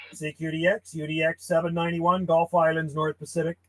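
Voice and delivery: measured, quiet call-sign read; radio CQ call. Acted. Ham operator calling CQ on the radio, steady call-sign delivery. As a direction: call out steadily over the air.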